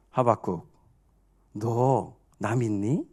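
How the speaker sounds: low, soft, slowing question; acted God tenderly asking for trust; God's voice. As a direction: ask it quietly and slowly, pitched low. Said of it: "주시는 말씀은 한마디로 말하면 이겁니다".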